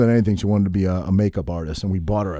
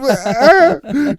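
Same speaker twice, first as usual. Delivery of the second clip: much higher, louder burst of laughter; laughing at himself curled up in a hotel room; 6 dB louder than usual. Real, not acted. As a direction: laugh at your own pathetic picture. Laughing at own fetal position.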